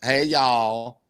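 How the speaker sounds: lightly imitated Southern greeting; a playful touch of Southern accent. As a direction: echo the guest's greeting with a Southern twang. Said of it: "who has the biggest Southern accent".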